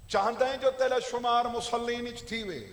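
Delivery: forceful oratory growing to a big shout; preaching climax. Real, not acted. Preacher warning the would-be worshipper not to leave Ali, building to a shout. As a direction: swell to a loud, ringing finish.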